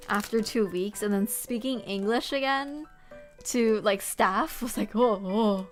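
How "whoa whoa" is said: ordinary delivery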